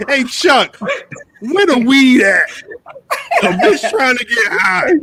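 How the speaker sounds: put-on a giggly high girl calling late; stoner. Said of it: "Patty calls at three am".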